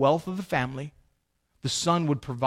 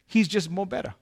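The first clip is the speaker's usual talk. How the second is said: put-on Italian accent; 4 semitones higher, 4 dB quieter than usual. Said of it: "do my best Italian accent".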